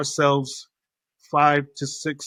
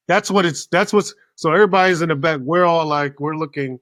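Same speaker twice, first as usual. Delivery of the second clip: normal talking voice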